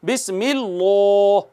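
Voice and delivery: बिस्मिल्लाह with rounded lips and a thick lam; heavy lam, wrong. Shown as a demonstration. Teacher showing students rounded lips make it heavy. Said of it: "होंठ गोल करेंगे तो मोटा हो जाएगा".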